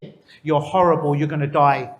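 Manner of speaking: mock insults. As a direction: sneer the insults at the plants. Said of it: "To the other plants, they say".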